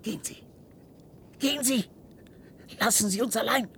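high-pitched panicked urging; frantic plea. Acted. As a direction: push them out in panic. Desperately sending them away.

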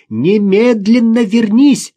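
high, loud, drawn-out shout; shouted order. A narrator's reading. Mother orders daughter back from the ice, shouting. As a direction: yell the command across a distance. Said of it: "закричала Ирина".

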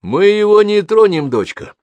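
high, loud calling out, softening on дочка; father shouting up reassuringly to his daughter; conciliatory shout. Acted. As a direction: shout up to calm her down. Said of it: "примирительно прокричал отец".

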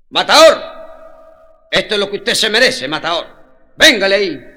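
loud mocking cheer, hailing him like a bullfighter; shouting crowd voice. Crowd mocks the thief with a loud cheer. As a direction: call out Matador to the arena.

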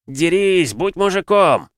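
loud, high taunting yell; heckling shout. Acted. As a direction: yell it from the stands. Woman heckles from the crowd.

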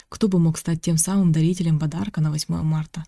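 usual speaking voice

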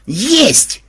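high, loud cry of triumph; exultant shout; delighted yell. A narrator's reading. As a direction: yell it in total rapture. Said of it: "в полном восторге завопил Ксенобайт".

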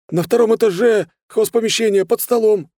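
high, loud, frightened voice; panicked confession. A narrator's reading. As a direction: spill it out in a high yell. Panicked thug gives up the safe. (панически выкрикивать высоким голосом)

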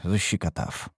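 usual speaking voice

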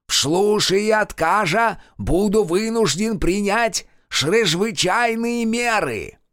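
high, loud, slow proclamation; booming official voice. Acted. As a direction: proclaim like a party official at a meeting. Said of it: "заговорил зычно".

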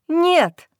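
a loud burst of protest; sudden cry. Acted. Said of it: "вырвалось у меня".

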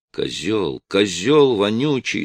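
ordinary delivery, nothing marked

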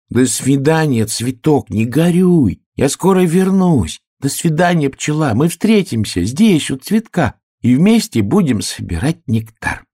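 high, cheerful piglet voice; piglet's farewell. Acted. Piglet bidding the flower and bee goodbye, high and cheery. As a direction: wave goodbye brightly as a little piglet.

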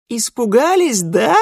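put-on high, loud teasing child; cheeky girl gloating; little girl voice. A narrator's reading. (голос озорной девочки)

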